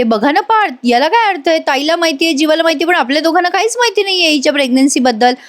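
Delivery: high-pitched aggrieved retelling; acted complaint. Kavya complaining to Parth about being left out, mock aggrieved.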